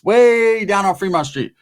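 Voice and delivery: ordinary delivery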